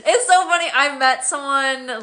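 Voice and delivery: loud, high, laughing through the words; laughing, amused. Real, not acted. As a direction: burst out amused as a memory comes back. Amused remembering mimicking accents.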